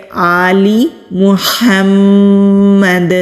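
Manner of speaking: آلی محمد slowly, kasra on lam, bounce on dal; careful recitation. Shown as a demonstration. Teacher recites آلی محمد with care. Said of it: "تو خیال کرتے ہوئے پڑھیں گے".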